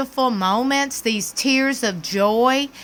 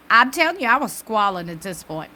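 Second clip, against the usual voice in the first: ordinary talk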